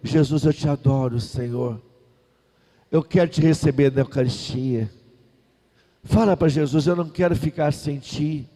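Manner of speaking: soft, tender prayer spoken for the people to repeat. Shown as a demonstration. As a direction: pray gently, leading them word by word. (oração suave e terna para repetir)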